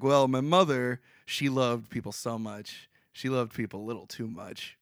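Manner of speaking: a hint of an old orator, dropped low and soft; understated statesman impression, low and measured; Churchill. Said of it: "obviously I'm doing the voice for Churchill".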